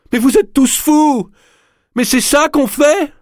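cri très aigu et lent, en pleurs; cri en larmes. Acted. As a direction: hurle d'indignation au bord des larmes. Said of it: "criant au milieu de ses larmes". (Lorenza crie en larmes, très aiguë)

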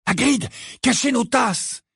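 high, pressing command; urgent. A narrator's reading. Hiding before the visitor enters, urgent.

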